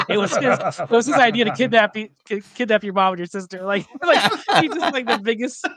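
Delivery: amused retelling, laughter breaking in; laughing while talking. Real, not acted. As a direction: tell it through real giggles.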